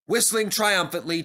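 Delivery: ordinary delivery, nothing marked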